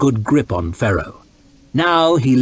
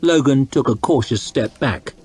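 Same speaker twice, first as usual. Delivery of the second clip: ordinary delivery, nothing marked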